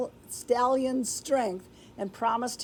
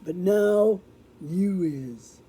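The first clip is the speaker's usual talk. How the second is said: lower, slow outlaw mumble; mumbled reply; 3 semitones lower, 3 dB louder, slower than usual. Acted. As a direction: mumble it low like a cowed gang boss. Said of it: "mumbled Gunsmith".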